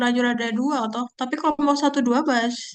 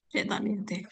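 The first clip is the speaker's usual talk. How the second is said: whispered aside; 10 dB quieter, faster than usual. Real, not acted. Muttering aside to herself.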